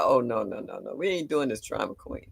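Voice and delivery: low, softening string of refusals; firm dismissal. Retold or imitated. Nana to the crying toddler, quietly dismissive. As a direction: shut the drama down in a dropped voice.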